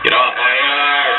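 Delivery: put-on grumpy old man. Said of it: "the old guy with the house".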